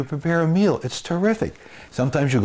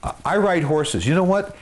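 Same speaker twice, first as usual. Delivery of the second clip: ordinary delivery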